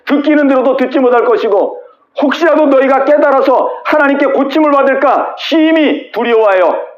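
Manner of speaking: 높여 힘주어 선포하는 예언; 백성 앞에서 외치듯; 예언의 선포. Acted. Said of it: "이스라엘 백성들에게 가가지고". (이사야가 백성에게 높게 외치는 선포)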